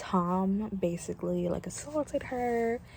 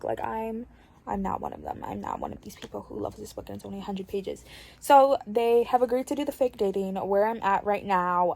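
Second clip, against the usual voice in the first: ordinary talk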